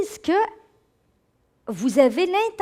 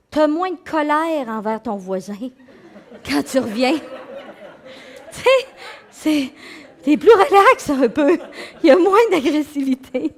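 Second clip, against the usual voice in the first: laughing; 2 semitones higher, 6 dB louder than usual. Real, not acted. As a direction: giggle through the joke about the neighbour. Laughs over the anger joke. (en riant aux éclats)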